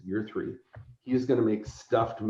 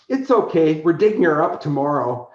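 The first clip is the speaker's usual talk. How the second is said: put-on higher, louder chirpy little girl voice; cheerful little girl; 5 semitones higher, 6 dB louder than usual. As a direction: play the cheerful creepy child. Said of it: "She goes".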